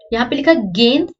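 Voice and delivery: गेंद said with a nasal n, gend; गेंद with the n sounded through the nose; with bindi. Shown as a demonstration. Teacher shows गेंद with bindi, nasal n. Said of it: "देखो बिंदी ना लगाने पे क्या फर्क पड़ेगा".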